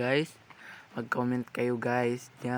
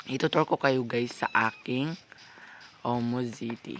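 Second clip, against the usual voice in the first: usual speaking voice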